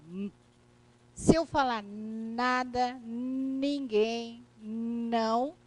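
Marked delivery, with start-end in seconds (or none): 0.1-0.3 a short hummed M; isolated M sound; M sound alone. Shown as a demonstration. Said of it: "pronunciando esse obstáculo". 1.8-5.5 long held N starting each word; drawn-out N in nada, ninguém, não; N pronounced emphatically. Shown as a demonstration. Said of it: "eu estou pronunciando".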